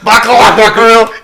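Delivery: loud, high cartoon yell; shouted catchphrase. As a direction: belt it like a catchphrase. Repeating the ringtone catchphrase.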